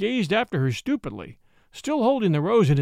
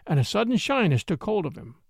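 ordinary delivery

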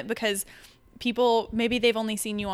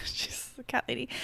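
laughing whisper; 8 dB quieter than usual. Real, not acted. Teasing aside about cat lady.

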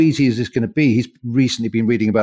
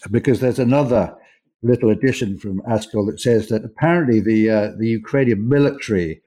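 normal talking voice

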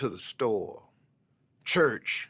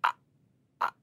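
stuttering. Retold or imitated. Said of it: "I couldn't get nothing out".

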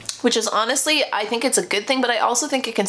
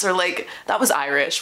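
usual speaking voice

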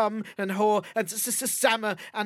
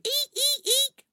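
high squealing piglet; slower than usual. Acted. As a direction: squeal it like a little pig.

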